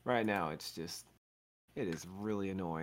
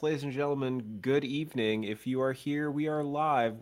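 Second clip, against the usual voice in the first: showman podcast opener; announcer voice; 4 semitones higher, 4 dB louder than usual. Acted. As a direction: welcome the crowd like a host. Podcast host opening announcement.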